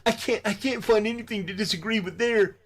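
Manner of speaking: high-pitched mocking imitation of a giggler; mock giggling schoolgirl. Critic imitating a rival who laughs like a schoolgirl, mocking. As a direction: imitate him tittering in a silly high voice. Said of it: "he's laughing like he's a schoolgirl".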